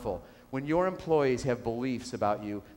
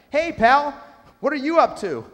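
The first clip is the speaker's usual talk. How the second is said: higher, louder hailing call; mock-cheery shout of hello; friendly call; 11 semitones higher, 8 dB louder than usual. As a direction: call out cheerfully to a worker across the site. Frenchman hails the next worker.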